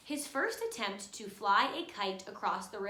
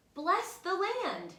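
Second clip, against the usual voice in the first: high, slow, solemn blessing voice; kites twisting; 8 semitones higher, 4 dB louder, slower than usual. A narrator's reading. Kites blessing the planting fields, high and slow. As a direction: bless the land from up in the sky. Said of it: "they twist".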